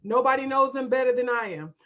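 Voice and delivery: normal talking voice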